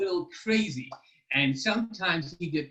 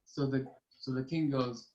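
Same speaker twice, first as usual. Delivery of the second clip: usual speaking voice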